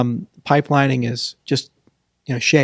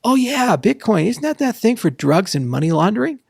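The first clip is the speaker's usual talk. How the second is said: higher-pitched, questioning mock layperson; skeptical normal person; 8 semitones higher than usual. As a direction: imitate a doubter asking a leading question. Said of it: "every conversation with a normal person of".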